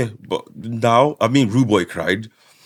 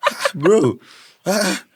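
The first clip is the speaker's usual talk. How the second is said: much higher, drawn-out disbelief; high incredulous whine; 12 semitones higher, slower than usual. Retold or imitated. Pleading with Mensah to stop crying, high and incredulous. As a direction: wail his name in high mock dismay. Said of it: "I'm like".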